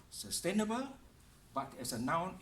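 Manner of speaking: ordinary delivery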